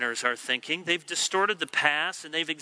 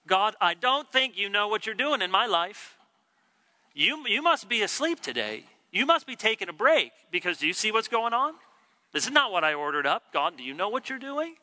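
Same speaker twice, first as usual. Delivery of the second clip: higher, indignant complaint; the congregant grumbling to God, higher and indignant; 5 semitones higher than usual. Retold or imitated. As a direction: whine up at God like a spoiled customer. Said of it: "a heart attitude toward God".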